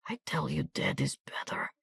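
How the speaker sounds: insist, barely breathing; wounded soldier murmuring that death is better; murmured. A narrator's reading. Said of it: "he murmured again".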